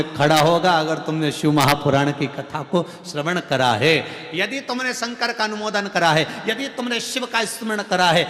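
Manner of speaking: loud, rising sermon cadence; fervent preaching. Acted. Preacher tells the listeners Shiva will stand by them, fervently. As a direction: drive the promise home to the crowd.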